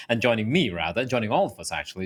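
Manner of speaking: ordinary delivery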